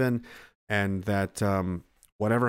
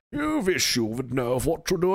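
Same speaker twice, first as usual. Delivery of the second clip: fancy tough guy. Retold or imitated. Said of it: "all fancy and tough".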